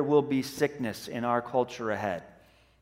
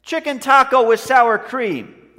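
loud, slow, higher-pitched order; imitated yell; 6 semitones higher, 6 dB louder, slower than usual. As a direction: holler an order across the room as the character. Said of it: "But then he yells".

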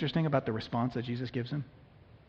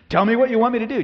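exasperated demand; 7 semitones higher, 7 dB louder, faster than usual. Acted. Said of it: "You're like".

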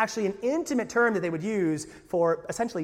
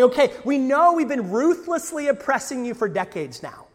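a hint of a smug ruler, louder and higher; Rome placating; 7 semitones higher, 6 dB louder than usual. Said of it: "this is Rome going".

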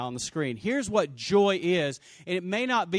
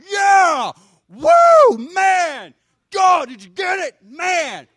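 much higher and louder, wild cheering; sports fan yelling; 13 semitones higher, 12 dB louder, much slower than usual. Retold or imitated. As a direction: scream at the game like a fan on the couch. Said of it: "you're like".

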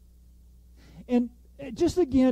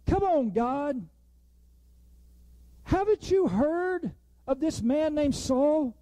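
higher, incredulous pleading with God; mock whiny disbelief; exasperated complaint; 6 semitones higher than usual. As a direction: protest like a friend who can't believe it.

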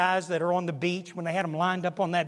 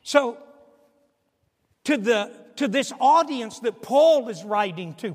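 ordinary talk

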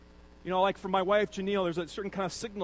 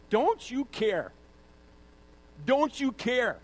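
slow, whiny complaint rising higher; mock indignant outburst; 6 semitones higher, slower than usual. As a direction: play the aggrieved sister demanding fairness. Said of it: "she says".

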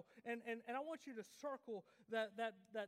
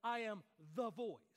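"the" hit loud and set apart in "the voice"; stress on THE; 6 dB louder than usual. Shown as a demonstration. Preacher showing a congregation the misreading, stress on THE. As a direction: punch "the" as if the only one. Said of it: "we don't read that as".

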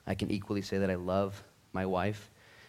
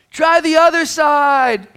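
loud, much higher, slow call; 19 dB louder, slower than usual. Acted. Jesus on the beach calling out to the boat in a slow, loud shout. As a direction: draw it out across the water. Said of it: "the voice cried out".

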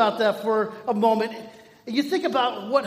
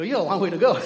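louder, teasing line breaking into laughter; laughing retort; 3 dB louder, much faster than usual. Retold or imitated. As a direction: laugh as you rub it in. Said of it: "I didn't laugh like that".

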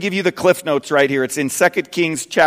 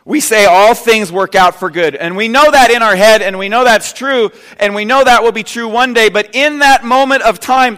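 building preacher voice, louder and higher; preaching; 9 semitones higher, 9 dB louder than usual. Acted. Preacher urging the congregation, full-force preaching. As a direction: press the point to the congregation.

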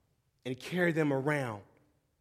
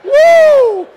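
much higher, much louder church shout; shouting praise; 21 dB louder than usual. Pretend. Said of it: "I wanted to go".

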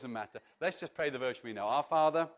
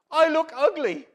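much higher, louder moaning complaint; whiny self-pity; 11 semitones higher, 12 dB louder than usual.

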